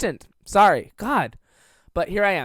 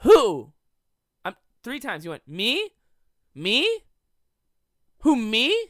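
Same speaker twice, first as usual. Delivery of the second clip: loud high startled who; mock a baffled friend; imitating startled friend; 5 semitones higher, 7 dB louder, slower than usual. Jacob thinking the joke is about him, mock startled. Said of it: "seriously was like".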